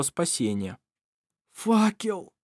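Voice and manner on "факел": high, strained moan of despair; moaning cry. A narrator's reading. Irovar moaning for the torch as Shira fades. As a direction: groan the word out in anguish. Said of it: "простонал Ировар".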